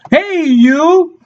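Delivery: high, cheery-sounding mock hail; calling out. Shown as a demonstration. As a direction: hail someone whose name escapes you. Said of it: "If you forget their name".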